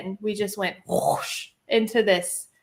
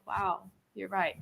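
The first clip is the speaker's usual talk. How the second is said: awed hush; 2 semitones lower, 10 dB quieter than usual. Retold or imitated. Quiet awed realisation retold.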